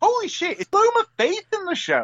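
high-pitched astonishment; real excited surprise. Real, not acted. Co-host spotting [name] in the cast, astonished. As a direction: pitch shoots up at the discovery.

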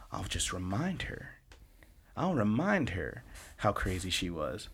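sly plan muttered to himself; scheming mutter. Retold or imitated. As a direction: plot it under your breath.

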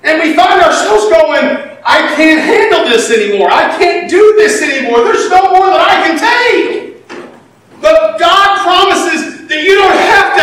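high, loud pulpit preaching; preaching at full force. Acted. Pastor preaching hard to the congregation, high and loud.

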